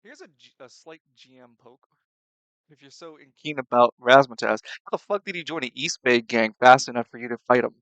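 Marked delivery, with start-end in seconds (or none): 2.7-7.7 loud challenge. Real, not acted. GM poke, heated question.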